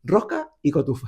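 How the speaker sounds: "Rosca y Cotufa" said the Canarian way, the final s dropped; local pronunciation. Shown as a demonstration. Asked how he pronounces it, says it island style. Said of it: "¿Tú, tú cómo lo pronuncias?".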